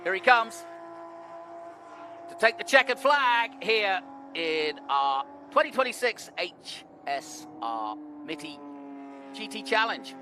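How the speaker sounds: live finish-line call, rising excitement; motorsport commentator. Real, not acted. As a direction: call the checkered flag like a race announcer.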